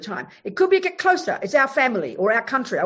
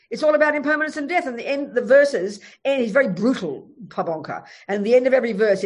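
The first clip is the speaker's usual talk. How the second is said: ordinary delivery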